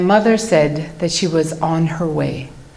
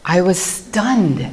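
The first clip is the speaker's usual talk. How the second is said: ordinary talk, nothing marked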